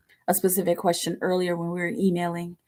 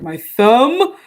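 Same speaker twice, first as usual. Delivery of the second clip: answering; 5 semitones higher, 12 dB louder than usual. Retold or imitated. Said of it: "I'm like".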